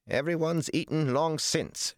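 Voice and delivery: high-pitched scolding woman; sharp, high rebuke; snapping. A narrator's reading. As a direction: snap it at him in a high voice. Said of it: "she snapped".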